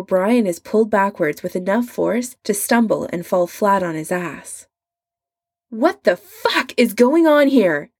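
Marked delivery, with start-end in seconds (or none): 5.7-7.9 loud, furious yell at the intruder; angry shout. A narrator's reading. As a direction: bellow it out, enraged. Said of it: "Adam shouts".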